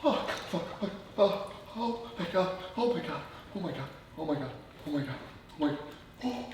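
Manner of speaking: high, strained breathless gasps; gasping through freezing cold. Real, not acted. Sitting in freezing cold water.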